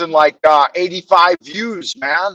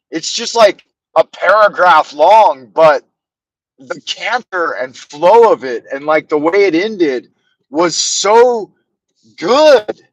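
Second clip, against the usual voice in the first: ordinary talk, nothing marked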